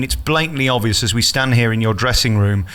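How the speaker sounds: ordinary talk, nothing marked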